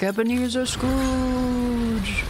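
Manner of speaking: put-on slow, spooky old miser; Ebenezer Scrooge voice. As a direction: drawl it like a grumpy old ghost. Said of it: "I'm doing my spooky voice".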